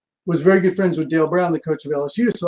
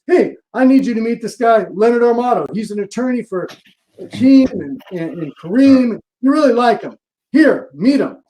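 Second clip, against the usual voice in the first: loud, higher, eager coach pitching; Dale talking him up; 5 semitones higher, 4 dB louder than usual. Retold or imitated. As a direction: sell the agent hard, loud and high. Dale hands over the phone.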